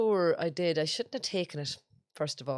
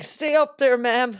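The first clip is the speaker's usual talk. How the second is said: loud, high call to stay put; calling out to tourist; 8 semitones higher, 12 dB louder, slower than usual. Retold or imitated. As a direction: shout the warning across the bus. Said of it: "I was like".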